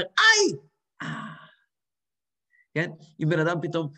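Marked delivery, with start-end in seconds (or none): 0.0-1.4 pain then relief. Shown as a demonstration. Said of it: "במצווה אתה אומר". (צעקת "איי" ואחריה אנחת רווחה)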